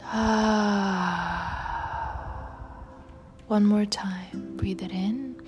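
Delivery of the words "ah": long breathy release sigh; audible sigh out. Shown as a demonstration. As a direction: sigh it all out. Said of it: "Sigh it out".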